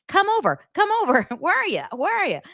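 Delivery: high mocking come over; lightly hammed-up friendly call; sarcastic imitation of a pal inviting; mock Higgins. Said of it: "And then Higgins saying".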